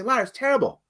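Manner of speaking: a lightly voiced objecting listener; mock the doubter; imagined critic. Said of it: "You might say".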